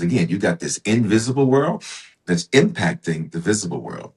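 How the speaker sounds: ordinary talk, nothing marked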